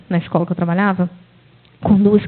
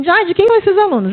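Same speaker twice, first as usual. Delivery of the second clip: high questioning colleague; 9 semitones higher than usual. Retold or imitated.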